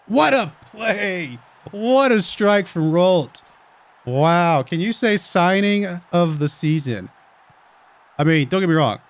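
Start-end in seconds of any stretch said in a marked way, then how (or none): 0.1-7.1 excited. Real, not acted. Goal scored, commentator erupts.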